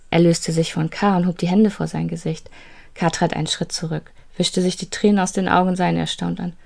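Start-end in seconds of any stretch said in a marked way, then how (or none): none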